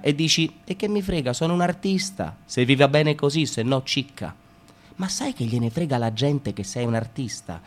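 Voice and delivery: ordinary talk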